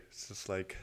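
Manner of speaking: usual speaking voice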